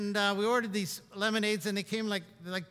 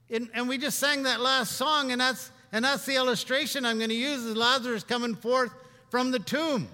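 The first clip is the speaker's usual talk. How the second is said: raised, ringing sermon voice; preacher building up steam, raised voice; fervent preaching; 4 semitones higher, 3 dB louder than usual. Acted. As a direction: preach it out to the congregation. Preaching with rising force.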